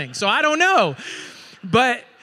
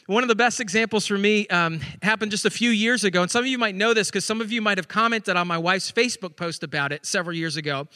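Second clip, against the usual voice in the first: usual speaking voice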